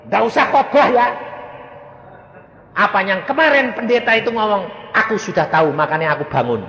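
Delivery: put-on high, loud, scolding old mother; imitating the mother. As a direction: snap at the pastor's son. Mother voiced in story. (menirukan ibu yang menegur keras)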